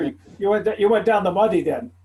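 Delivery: ordinary delivery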